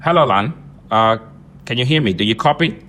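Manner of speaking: ordinary talk, nothing marked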